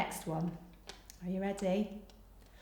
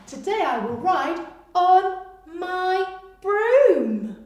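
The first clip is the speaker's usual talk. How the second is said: very slow, high and loud; slow reveal; 8 semitones higher, 10 dB louder, much slower than usual. Shown as a demonstration. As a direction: read the answer out slowly. Teacher reveals answer.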